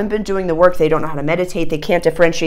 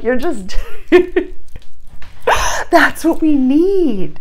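normal talking voice

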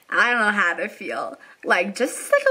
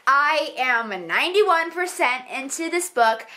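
normal talking voice

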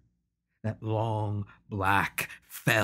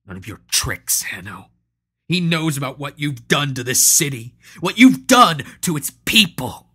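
angry; 4 semitones higher, 9 dB louder than usual. Acted. Patro confronting Hanno angrily.

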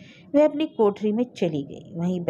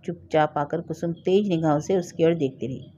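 usual speaking voice

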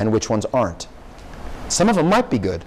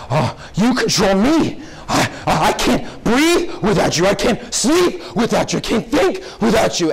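slow, higher, hammed-up swooning lover; mock lover; 6 semitones higher than usual. Mocking the Scandal hero to students, hammed-up swooning lover. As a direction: overplay the desperate romantic, pleading. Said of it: "he's like".

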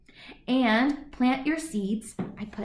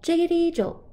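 jiggity jumps up high and loud; high bouncy jolt; 8 semitones higher, 4 dB louder than usual. Acted. Refrain, second take high.

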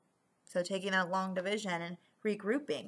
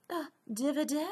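higher-pitched put-on English accent; 5 semitones higher, faster than usual. Shown as a demonstration. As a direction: try it in an English accent, like a question. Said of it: "say it with a English accent".

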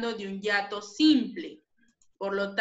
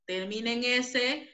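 ordinary talk

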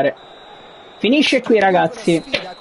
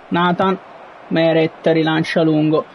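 ordinary talk

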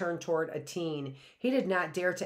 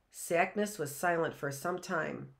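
usual speaking voice

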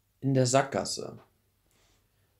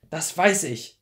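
defiant retort; 6 semitones higher, 6 dB louder, slower than usual. Acted. Defiant reply to Hodge.

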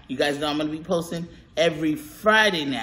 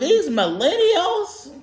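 put-on much higher, very slow scolding old lady; old lady grumble; 11 semitones higher, much slower than usual. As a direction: drag it out like a fussy grandma. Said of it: "I sound like an old lady when I talk like that".

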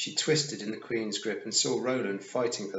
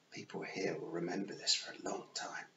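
softer breathy threat, leaned in close; menacing whisper; 3 semitones lower, 10 dB quieter than usual. A narrator's reading. The Queen leans down and whispers a threat to Tiffany. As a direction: breathe the threat into her face.

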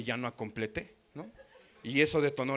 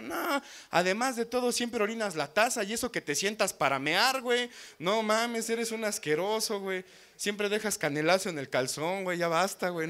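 imitating her nagging, higher pitched; higher mock rant; 6 semitones higher, 3 dB louder than usual. Girlfriend keeps insulting him, higher mock scolding. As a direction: keep the insults coming in a high mock scold. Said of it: "Diciéndome cosas como".

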